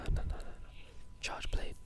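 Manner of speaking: ordinary talk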